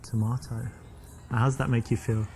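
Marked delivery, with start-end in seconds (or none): 0.0-0.7 "tomato" as "to-MAH-to" in an English accent. Shown as a demonstration. Man flirting with a woman using his accent, English accent. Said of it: "with my English accent".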